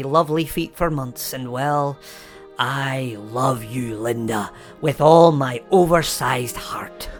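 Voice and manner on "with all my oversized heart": whisper. Acted. End of love confession.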